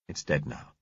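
low, soft grown-up answer; reassuring man. A narrator's reading. Driver answers girl after shooting triffid, quietly reassuring. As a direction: settle it quietly and low for a child.

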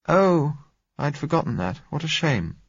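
high, lightly voiced letdown sigh of a line; disappointed. A narrator's reading. Gisella finding there is no hot water, disappointed. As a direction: let the hope drop out of it. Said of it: "she exclaimed disappointedly".

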